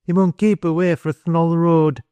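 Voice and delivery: high-pitched, urgent dialect warning; urgent outburst. A narrator's reading. Boy warns the man off the road, urgent outburst. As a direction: burst out with a boy's warning. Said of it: "the rest came in a burst".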